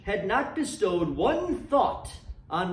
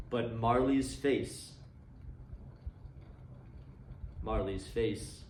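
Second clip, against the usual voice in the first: hushed reveal; 7 semitones lower, 10 dB quieter, slower than usual. A narrator's reading. Ghostly reveal, repeated.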